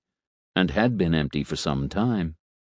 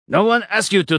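loud, much higher angry snap; snapping; 13 semitones higher, 4 dB louder than usual. Acted. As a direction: bite the words off angrily. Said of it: "Vlad snapped".

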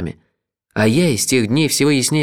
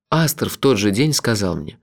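ordinary delivery

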